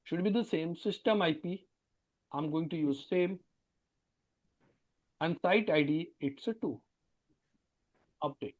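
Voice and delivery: faint, far-off voice; barely audible. Real, not acted. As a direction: voice dropping out of reach. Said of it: "Sir, your voice is very dimmed".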